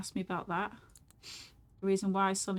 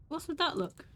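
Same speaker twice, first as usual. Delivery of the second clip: pitched up, pressing hard on that; tense question; 7 semitones higher than usual. Acted. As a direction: demand it, stressing that. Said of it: "So what you just said again".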